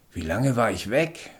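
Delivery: ordinary delivery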